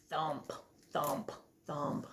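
low, soft thumping; soft thumps. Acted. Distant footsteps drawing near.

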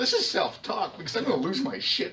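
cracking up while voicing grandpa; laughing mock grandpa. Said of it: "Like, it was, like, straight up".

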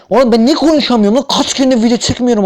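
hızlı, yüksek perdeli, yüksek sesli şikâyet; lafı karıştırınca patla; kendine kızgın. Real, not acted. Dili dolaşınca kendine kızıyor. (dili sürçünce hızlı ve yüksek sesle kendine çıkış)

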